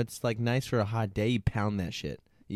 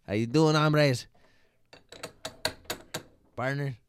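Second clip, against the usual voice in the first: loud buddy greeting; 5 semitones higher, 6 dB louder than usual. Retold or imitated. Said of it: "I'm all like".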